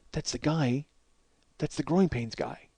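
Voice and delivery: wife secretly pointing out the star; hushed wife. Retold or imitated. Wife whispering in restaurant.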